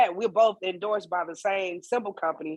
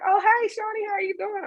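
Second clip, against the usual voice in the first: high, bright imitation of the lady, much higher pitch; mimicked lady's greeting; 14 semitones higher than usual. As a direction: chirp it up high like an excited fan greeting you. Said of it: "She's like".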